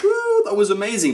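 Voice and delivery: lightly acted whoop of praise; mock cheering. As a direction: imitate an impressed crowd. Imagined audience clapping and cheering.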